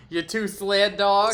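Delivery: imitating a New York accent; mock New York twang on dogs. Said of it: "I can't even do the New York accent".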